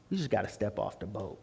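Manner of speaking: low, very soft counsel; quiet advice. Retold or imitated. Friend advises him to take the leap, quietly. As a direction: drop it down and say it gently.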